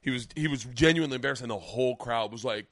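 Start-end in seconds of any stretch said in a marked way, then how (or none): none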